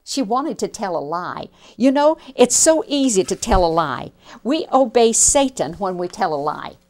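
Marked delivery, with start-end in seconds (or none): 1.8-6.8 put-on louder preaching to the children; preacher warning children about lies; preaching aside. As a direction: drive the lesson home.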